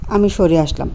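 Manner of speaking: ordinary delivery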